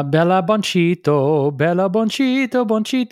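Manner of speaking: sing-song up to a high Bonchito; rising pet name call. Shown as a demonstration. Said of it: "my newest nickname for her".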